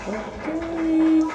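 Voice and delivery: high, soft and drawn-out okay; a baby shrugging it off; baby unimpressed. Retold or imitated. Baby answering the old man, unimpressed and soft.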